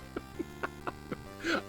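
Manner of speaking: high wheezy giggle; helpless laugh. Real, not acted. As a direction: lose it laughing. Cracking up at bad game.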